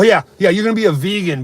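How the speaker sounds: ordinary talk, nothing marked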